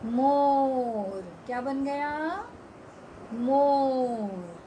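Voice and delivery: मोर stretched very slowly; slow word reading. Shown as a demonstration. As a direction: sound out मोर for kids. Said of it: "ओ की मात्रा लगा दी".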